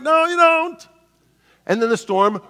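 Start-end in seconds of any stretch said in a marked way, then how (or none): 0.0-0.9 mocking sailors. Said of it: "they're like".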